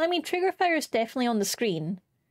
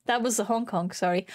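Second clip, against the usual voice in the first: ordinary delivery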